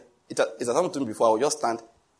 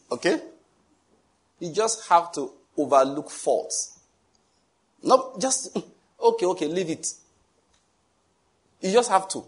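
normal talking voice

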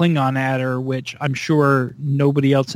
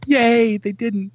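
higher pitched giddy relief; relieved cheer; 7 semitones higher, slower than usual. Retold or imitated. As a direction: let the relief bubble up.

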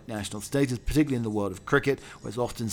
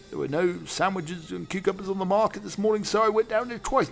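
much higher butler voice; butler Lane; 8 semitones higher than usual. Acted. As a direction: answer as a stiff manservant.

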